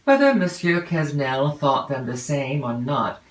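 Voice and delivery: ordinary delivery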